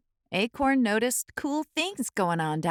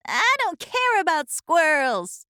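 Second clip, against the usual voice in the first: a touch of a higher defiant voice; defiant acorn; 7 semitones higher than usual. A narrator's reading. Acorn brushing off the oak's warning, lightly defiant. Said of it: "said Acorn".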